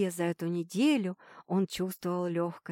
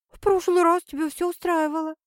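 upset; 9 semitones higher, 5 dB louder than usual. Acted. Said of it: "даже расстроилась".

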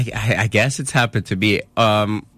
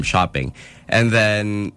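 ordinary talk, nothing marked

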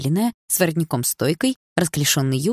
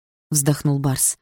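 ordinary delivery, nothing marked

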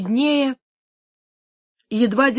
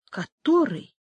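agitated question, pitched higher; anxious; 6 semitones higher, slower than usual. A narrator's reading. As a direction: ask in tense expectation. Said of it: "спросила Инесс в волнении и тревожном ожидании". (взволнованно и тревожно)

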